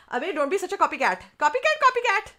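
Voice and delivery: put-on a schoolkid taunting a classmate in a high Desi accent. Schoolkid taunts a copying classmate, high teasing Desi accent. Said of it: "I'm gonna totally do my full Desi accent on this one".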